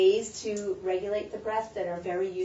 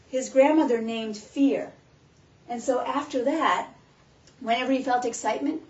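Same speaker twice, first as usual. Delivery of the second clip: normal talking voice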